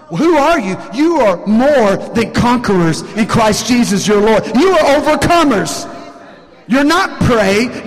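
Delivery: emphatic, ringing declaration; forceful preaching. Real, not acted. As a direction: proclaim it over the congregation.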